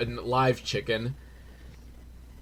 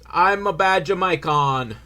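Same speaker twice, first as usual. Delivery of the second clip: loud, higher-pitched fake Jamaican accent; bad Jamaican accent; 5 semitones higher, 4 dB louder, slower than usual. Shown as a demonstration. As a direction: put on a hammed-up, terrible Jamaican accent. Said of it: "every time I try to do an accent".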